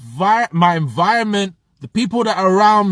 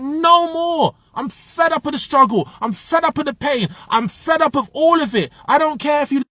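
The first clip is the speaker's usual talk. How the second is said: higher, fired-up inner voice; fed-up outburst; 7 semitones higher than usual. Retold or imitated. Telling viewers how he quit, voicing his fed-up self. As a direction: relive the moment you snapped and said no more. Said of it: "I was like".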